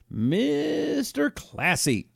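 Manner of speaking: drawn-out high sarcastic sneer. Real, not acted. Host mocking Al Franken's photo, slow high sarcasm. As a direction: stretch it out, dripping with mockery.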